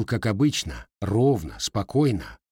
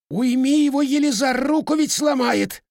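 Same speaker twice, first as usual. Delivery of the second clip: much higher, louder whining plea; whimpering; 4 dB louder than usual. A narrator's reading. Twisted-up man begs the elder to stop the lad, whimpering. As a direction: whine for help like a beaten dog. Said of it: "проскулил скрученный озверевшим Матвеем мужик".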